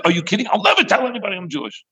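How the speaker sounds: high, rushed protest; incredulous son. Acted. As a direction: blurt out disbelief to your mother. Son incredulous at mother's advice.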